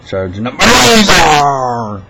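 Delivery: high, loud, long-drawn holler; hammed-up shout. Shouted bit meant for a friend, hammed-up holler. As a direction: stretch the last word into a huge yell.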